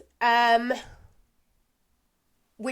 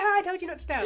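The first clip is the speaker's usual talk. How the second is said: high-pitched, laughing imitation of protest; laughing mock complaint; 8 semitones higher, much faster than usual. Son complaining that dad told, laughing mock protest. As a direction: mimic a whine through laughter.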